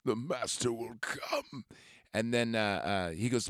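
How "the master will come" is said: slow, hushed prophetic whisper; ominous whisper. Retold or imitated. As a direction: whisper it like a dark promise. Renfield speaks of master.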